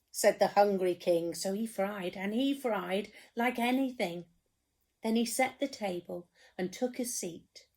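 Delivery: ordinary delivery, nothing marked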